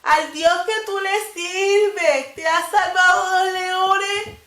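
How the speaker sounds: high, slow mournful call into the pit; a king calling sadly into the lions' den. Acted. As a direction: cry out his name in sorrow, drawn out. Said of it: "gritarle tristemente".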